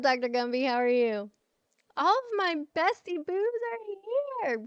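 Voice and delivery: high, gleeful roll call of friends; excited welcome. Real, not acted. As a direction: gush as the whole gang turns up. Welcomes all her friends arriving.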